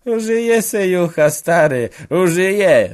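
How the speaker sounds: loud, high mocking call. A narrator's reading. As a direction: shout it with a leer at the old man. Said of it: "wołał wójt". (wójt głośno i wysoko woła z drwiną)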